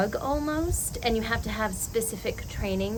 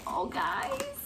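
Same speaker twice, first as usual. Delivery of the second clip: surprised; 5 dB quieter than usual. Real, not acted. Recognizing the gift.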